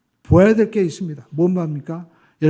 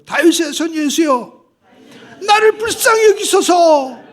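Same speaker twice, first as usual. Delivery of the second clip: high, loud, slow desperate cry; 9 semitones higher, 5 dB louder, slower than usual. Shown as a demonstration. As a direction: cry out for mercy at full voice, stretching each word. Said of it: "우리가 또 한번 외쳐볼까요?". (높고 크게 천천히 외치는 간청)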